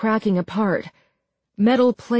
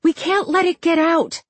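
high, urgent protest; alarmed cry; 9 semitones higher than usual. A narrator's reading. Marla to Jessica as they reach the door, alarmed cry. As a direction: cry out in alarm, pitched well up. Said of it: "Marla cried as they reached the door".

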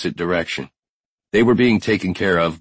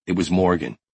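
plain reading voice

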